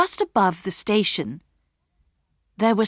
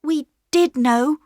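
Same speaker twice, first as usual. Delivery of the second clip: higher, brave confession; girl Bobby; 9 semitones higher, 5 dB louder than usual. A narrator's reading. Bobby to the station master, bravely confessing. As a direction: own up beside her brother. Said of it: "said Bobby".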